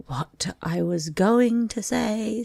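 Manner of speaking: high, slow, huffy; drawn-out offended pomp. Acted. The dodo, slighted by the eaglet, huffily and slowly. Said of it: "said the dodo in an offended tone".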